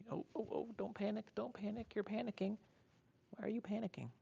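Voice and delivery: calm a panicking pet up high and gently; soothing pet talk. Real, not acted.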